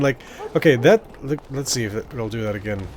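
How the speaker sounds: usual speaking voice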